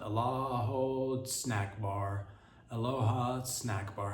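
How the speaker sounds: ordinary talk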